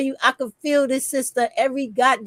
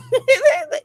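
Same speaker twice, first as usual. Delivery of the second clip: high laugh; 12 semitones higher than usual. Real, not acted. Laughing at the strike story.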